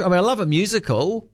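usual speaking voice

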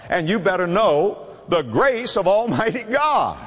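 ordinary delivery

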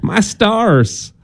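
a hint of a Southern accent. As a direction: play a genteel Southern woman gasping. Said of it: "only say that with a Southern accent".

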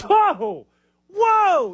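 high, astonished whoa; amazed. Real, not acted. Stunned by a coach saying I don't know. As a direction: gasp it out in disbelief.

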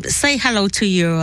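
ordinary talk

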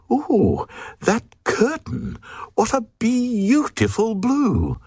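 high, airy queen voice gushing over a curtain; delighted queen. A narrator's reading. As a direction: coo over it like a dazzled queen.

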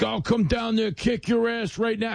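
high, gravelly mock threat; Tom Homan impression. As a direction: imitate a ridiculous gruff voice making threats. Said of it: "your gravelly, ridiculous voice".

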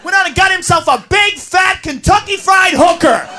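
ranting. Acted. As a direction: bellow it with mock glee. Ranting comic bit.

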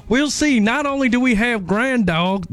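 hammed-up imitation of a country star; [name] impression. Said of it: "No, that's [name]".

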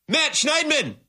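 calling the name out high in disbelief; exasperated call-out. Real, not acted. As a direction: cry his name like he let you down. Host exasperated at reporter's question.

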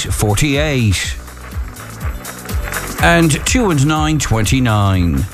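slow calling of bingo numbers; bingo caller. Acted. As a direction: call each number out with pauses.

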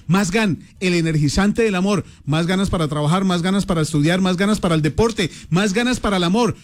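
usual speaking voice

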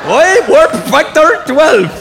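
loud, drawn-out mock-Scottish accent, high; Scotty. Comedian mocking the Star Trek engineer, drawn-out Scottish accent. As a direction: stretch it in a hammed-up Scottish accent. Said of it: "Scotty in Star Trek".

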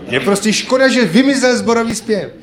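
usual speaking voice

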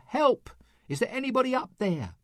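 high, fast panicked call upward; desperate cry for help. Acted. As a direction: hiker calling up from the cliff, pitched high and fast. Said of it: "the hiker called out".

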